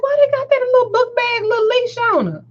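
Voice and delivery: high, sing-out mock bafflement; judgmental disbelief. Shopper wondering about the book bag leash, incredulous. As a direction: imitate a puzzled onlooker way up high. Retold judging reaction.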